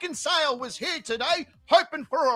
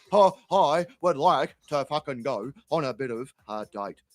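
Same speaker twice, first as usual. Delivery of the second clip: Terry voice; 11 semitones lower than usual. Retold or imitated. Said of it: "He goes".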